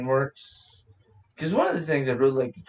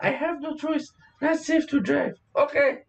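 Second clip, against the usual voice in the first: high voice; 10 semitones higher than usual. Pretend. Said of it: "voicing body, high".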